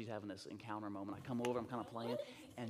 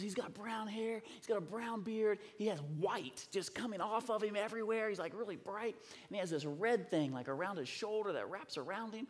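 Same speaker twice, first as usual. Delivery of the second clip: higher, eager listing of details; eager child; 7 semitones higher than usual. Retold or imitated. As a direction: imitate a child describing what she saw. Child eagerly describes her vision.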